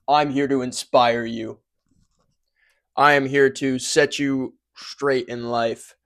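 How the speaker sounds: loud, deliberate motivational proclamation; inspirational intro. Pretend. Host trying out a fancy intro, mock-inspirational and loud. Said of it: "figure out how to be inspirational".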